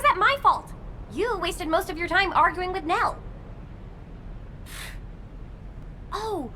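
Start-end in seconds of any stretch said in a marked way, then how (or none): 3.2-6.1 quiet, breathy exhale; tired breathy sigh; soft sigh. Acted. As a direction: let out a little sigh. Sigh before noticing Enzo.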